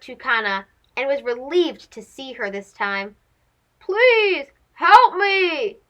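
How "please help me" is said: slow, drawn-out plea; begging. Acted. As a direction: stretch each word, begging. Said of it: "begged Rico".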